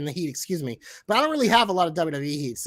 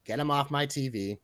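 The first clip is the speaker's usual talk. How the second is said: lower, softer take of the catchphrase; quiet catchphrase; 4 semitones lower, 6 dB quieter than usual. Pretend. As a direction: say it low and hushed, changing it up. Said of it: "to change it up a little".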